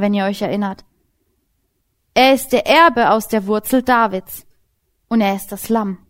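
usual speaking voice

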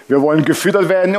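usual speaking voice

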